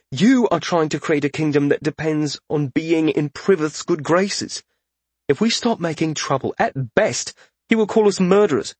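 high, anxious argument; urgent warning. A narrator's reading. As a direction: press the warning with rising urgency. Urgent warning of political consequences.

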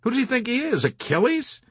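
high-pitched, indignant question; incredulous scoff. A narrator's reading. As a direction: ask it in scornful disbelief. Colonel scoffing at refusal.

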